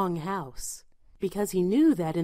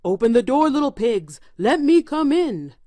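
louder, mildly angry demand; furious wolf; 4 semitones higher, 5 dB louder than usual. A narrator's reading. Wolf demanding entry at the brick house, mildly angry. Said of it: "perfectly furious".

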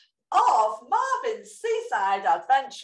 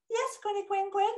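giggly puppet voice; 8 semitones higher, 5 dB quieter than usual. Acted. Puppet answers, laughing.

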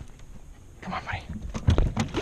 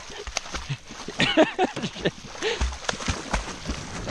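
high breathless squealing laugh; wheeze-laugh, helpless at the dog; squeaky unvoiced giggle fit; real laugh; 7 dB quieter than usual. Real, not acted. Dog mishap at the net.

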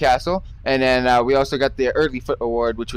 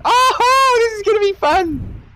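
mock excitement; 4 dB louder, slower than usual. Mock excitement at penalties drill.

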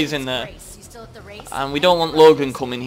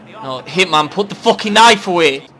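loud, raised insistence; exasperated order; 3 semitones higher, 8 dB louder than usual. Pretend. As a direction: repeat the order louder, losing patience.

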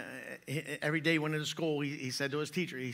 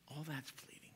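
hushed repeat; 17 dB quieter than usual. Shown as a demonstration. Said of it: "repeated softly".

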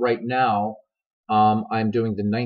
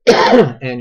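coughing; 12 dB louder than usual. Real, not acted. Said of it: "you'll pardon the coughs".

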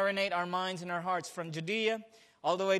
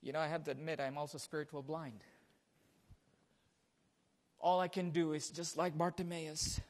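much softer, intimate admission; soft confession; 5 semitones lower, 10 dB quieter than usual. Acted. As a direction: confess it quietly to the flock.

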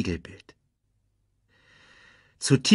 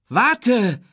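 high call of "Warte!", much higher and louder; young lion calling after the baboon, loud and high; 13 semitones higher, 5 dB louder than usual. Acted. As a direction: shout after someone running away. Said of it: "rief Simba".

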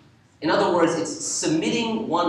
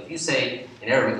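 ordinary delivery

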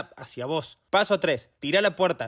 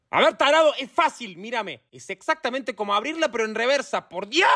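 higher-pitched, fed-up scolding; exasperated; 4 semitones higher than usual. Acted. Instructor to a clumsy door-closer, exasperated. Instructor loses patience with pupil.

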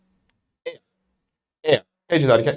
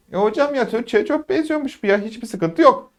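high-pitched student, lightly softer; mock-naive student voice, pitched up; imitated student; 7 semitones higher, 3 dB quieter than usual. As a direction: play a pupil finding it all easy. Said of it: "diye gidiyorsunuz".

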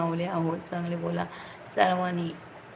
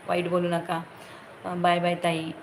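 ordinary talk

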